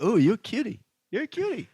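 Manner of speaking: high, fond cooing at a cutie. Retold or imitated. Reacting fondly to a Scottish accent, high cooing. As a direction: coo it up high like admiring someone. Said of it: "I feel like".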